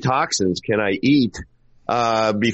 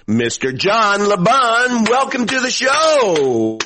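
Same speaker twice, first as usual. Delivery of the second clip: grand mock introduction, slowing on Mister John LeBon; showman announcer intro; 4 semitones higher than usual. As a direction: hype up the guest like a ring announcer. Host introduces guest like announcer.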